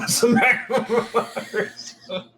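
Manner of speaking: laughing through the question. Real, not acted. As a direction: giggle between the words. Asking about racism limits, laughing.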